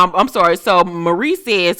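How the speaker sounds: normal talking voice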